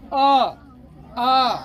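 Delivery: अ and आ pushed loud from the throat; throat sound demo. Shown as a demonstration. Teacher showing pupils throat sounds, loud and open. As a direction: belt out अ, आ from the throat. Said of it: "इसमें बोलने के लिए एकदम सीधा कंठ की जरूरत".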